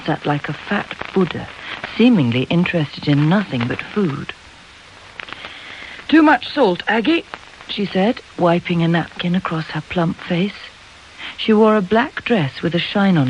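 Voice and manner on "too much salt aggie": high-pitched table complaint, louder on the first words; plaintive mother. A narrator's reading. Mother grumbling to her daughter over dinner, plaintive.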